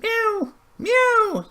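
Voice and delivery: very high, loud falling meow; crying meow. A narrator's reading. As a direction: cry out like a cat. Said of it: "cried Simpkin".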